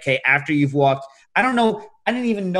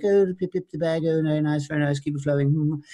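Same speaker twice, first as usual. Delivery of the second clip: mock posh British. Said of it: "in England, they're like".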